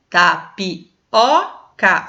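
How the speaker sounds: TAPIOCA very slowly, syllable by syllable, O strongest; drawn-out Tapioca with a strong open O; stress on the O. Shown as a demonstration. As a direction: separate ta, pi, o, ca in tapioca and lean on the O. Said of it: "a sílaba tônica".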